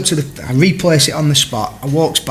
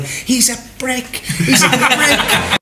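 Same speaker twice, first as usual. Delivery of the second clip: imitated distant shouting; 9 semitones higher, much slower than usual. Teammate complaining to Brucey across the pitch, mock shouting. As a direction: stretch it out like calling across the pitch. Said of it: "I can see him shouting over at Brucey".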